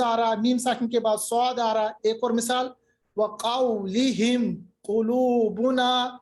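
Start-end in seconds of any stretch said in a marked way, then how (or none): 3.2-6.2 وَقَوْلِهِمْ قُلُوبُنَا in slow measured recital; clear mim. Shown as a demonstration. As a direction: sound the mim of وَقَوْلِهِمْ plainly before the qaf. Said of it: "قاف آ رہا ہے تو اظہار ہوگا". (اظہار کے ساتھ ٹھہر کر تلاوت)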